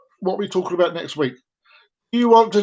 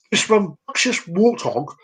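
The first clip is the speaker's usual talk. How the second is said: usual speaking voice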